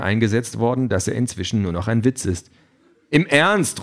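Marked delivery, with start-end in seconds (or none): 3.1-3.8 high, insistent call; urgent raised protest. A narrator's reading. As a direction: insist on it, pitch jumping up. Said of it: "ruft der Anführer".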